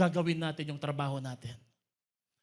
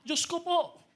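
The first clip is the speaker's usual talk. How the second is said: much higher and louder, urgent exhortation; full-force preaching; 12 semitones higher, 8 dB louder than usual. Acted. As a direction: drive the point home from the pulpit.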